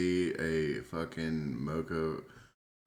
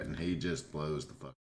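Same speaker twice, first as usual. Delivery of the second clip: ordinary delivery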